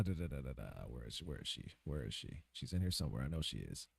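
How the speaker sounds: soft, low mumbled search; quietly talking to yourself while scrolling; muttering to self. Real, not acted. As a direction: hum and mutter under your breath while looking.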